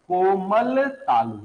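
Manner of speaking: "कोमल तालु" said slowly and clearly for notes; slow classroom dictation of "कोमल तालु"; dictated slowly. Shown as a demonstration. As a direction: spell out "कोमल तालु" slowly so pupils can write. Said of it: "लिख लो, इसे कहते हैं कोमल तालु".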